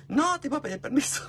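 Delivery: high, put-on innocent voice; mock innocent reply. Answering Gia after being caught, mock innocent falsetto. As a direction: play dumb in a falsetto.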